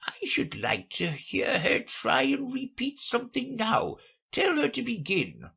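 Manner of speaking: high, fussy creature voice; an old turtle giving orders; Mock Turtle's voice. A narrator's reading. Mock Turtle asks Alice to recite, high fussy voice.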